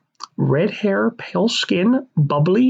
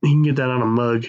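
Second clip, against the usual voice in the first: normal talking voice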